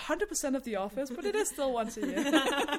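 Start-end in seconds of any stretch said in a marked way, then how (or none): 1.1-2.8 said through laughter, louder; a laughing aside, higher and louder. Real, not acted. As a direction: laugh out the punchline. Laughing at own punchline.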